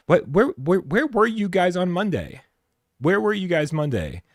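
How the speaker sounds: high, loud demanding where were you; mock angry. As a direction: playfully scold the audience. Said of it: "I'm not actually angry".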